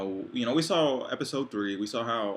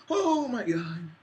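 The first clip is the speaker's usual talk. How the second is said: high, drawn-out fangirl gush; swooning; 10 semitones higher, 5 dB louder, much slower than usual. Real, not acted. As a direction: swoon loudly over a crush, stretching it out. Swooning over singer fantasy.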